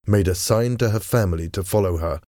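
ordinary delivery

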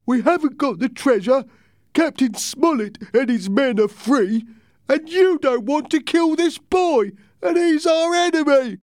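high, loud, angry sailor voice. Acted. Angry crewman accusing Silver, high and loud. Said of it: "his face red and angry".

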